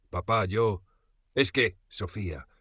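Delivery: faltering, unfinished phrases; stammering. A narrator's reading. Son fumbles excuses to his father, stammering. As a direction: grope for an answer. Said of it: "balbuceaba, incapaz de dar una respuesta coherente".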